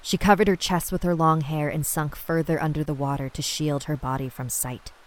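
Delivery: ordinary delivery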